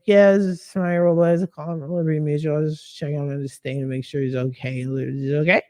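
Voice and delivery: lifeless, rattled-off phone script; dead script reading. Shown as a demonstration. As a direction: recite it quickly, dropping away with no life in it. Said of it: "I'm saying it dead".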